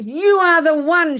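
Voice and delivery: high, loud, slow mock scream; stretched, hammed-up shouted accusation; intense accusation. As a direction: act out the neighbor's intense yell. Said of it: "It was so intense".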